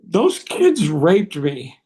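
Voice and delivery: acted small boy slowly reporting to a grown-up; lightly played tearful kid; upset little kid. Retelling himself as crying little boy.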